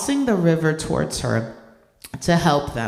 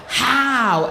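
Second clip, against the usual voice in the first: bewildered how; 7 semitones higher, 5 dB louder than usual. Retold or imitated. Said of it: "And we were like".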